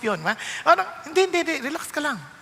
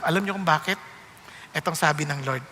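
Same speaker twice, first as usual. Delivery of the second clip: normal talking voice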